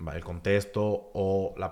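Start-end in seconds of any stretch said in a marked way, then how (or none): none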